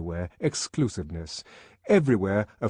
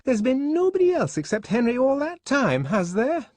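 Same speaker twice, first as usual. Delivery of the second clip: high, louder, pointed reproach; Fanny accusing Lenina, raised and louder; 5 dB louder than usual. A narrator's reading. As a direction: jab the question at her. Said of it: "pointing an accusing finger".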